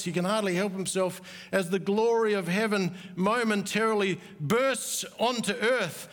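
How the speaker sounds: ordinary talk, nothing marked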